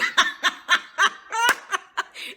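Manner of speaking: high, loud laugh; burst of laughter. Real, not acted. Laughing at the recap.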